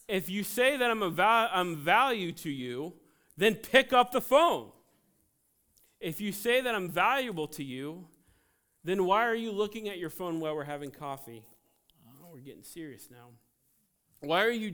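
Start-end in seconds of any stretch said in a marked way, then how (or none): 0.1-4.7 fiery preaching. Acted. Preaching at full force. 6.0-11.4 fiery preaching. Acted. Preaching at full force.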